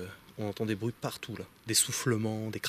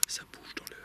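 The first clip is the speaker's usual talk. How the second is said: hushed whisper in the dark; 10 dB quieter than usual. Real, not acted. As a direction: breathe the words out, barely voiced. Hunting in dark forest, noises. (chuchotement inquiet)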